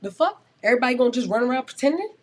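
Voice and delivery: sudden loud, fast exasperated rant; heated outburst. Real, not acted. Rapper venting to the podcast host about fakes, loud and fast. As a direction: blurt it out, fed up and loud.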